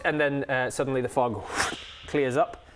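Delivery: normal talking voice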